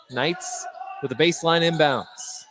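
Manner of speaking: usual speaking voice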